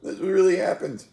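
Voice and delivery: high, loud, laughing amazement; laughing disbelief. Real, not acted. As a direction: laugh at your own tears. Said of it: "fruit brought me to tears".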